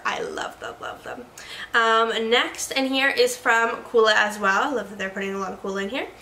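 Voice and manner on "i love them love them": low whispered fond aside. Real, not acted. Gushing over the Coola brand, whispered. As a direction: breathe it like a secret.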